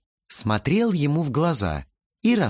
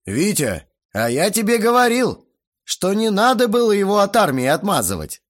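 raised, high-pitched sneer; caustic; 10 semitones higher, 5 dB louder than usual. A narrator's reading. Guest blames father for the son's upbringing, caustic. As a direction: rub it in with venom. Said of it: "язвительно кривя губы сказал Грохотов".

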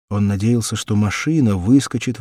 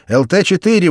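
loud, higher radio call; shouting over radio; 8 semitones higher, 7 dB louder than usual. A narrator's reading. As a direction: shout the callsign urgently. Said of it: "вызывал радист".

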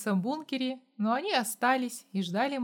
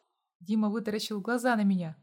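plain reading voice

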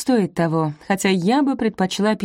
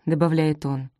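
ordinary delivery, nothing marked

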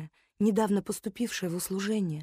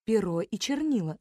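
ordinary delivery

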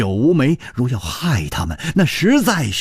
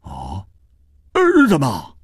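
very high, startled questioning; stutter, then squeak out the question; incredulous high-pitched shock; stammering astonishment. Acted. Astonished at hearing she is blind.

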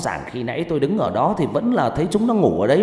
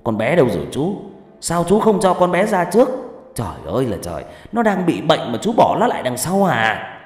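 loud, heated reproach with raised pitch; angry scolding; 6 semitones higher, 5 dB louder than usual. Acted. Man scolding his companion for leaving the sick child, angry. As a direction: demand answers in exasperation.

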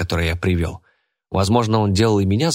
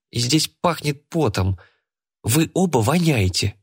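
put-on high girl's voice, wrinkling her nose; nose wrinkled; 6 semitones higher than usual. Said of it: "Она сморщила нос".

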